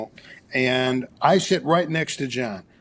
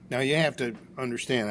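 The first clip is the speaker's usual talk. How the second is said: usual speaking voice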